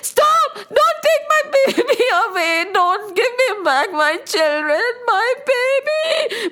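put-on high, crying mother begging for her baby; pleading mother. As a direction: beg desperately like a mother losing her child. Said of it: "And the mother will say".

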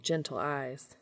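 usual speaking voice

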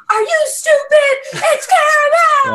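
high, loud hammed-up yell; mock outraged shout. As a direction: imitate a customer yelling in a fight. Acting out customers fighting over pronunciation.

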